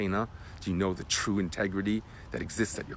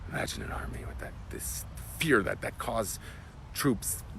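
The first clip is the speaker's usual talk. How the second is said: whispered, slowing down; hushed awe; 5 dB quieter than usual. Real, not acted. Teacher to class on the army's fear, whispered. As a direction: draw them in with a whisper.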